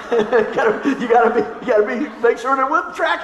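real laughter breaking up the sentence; laughing. Real, not acted. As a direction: try to talk while cracking up. Cracked up at own joke.

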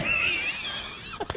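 high whooping laugh; laughing shout. Real, not acted.

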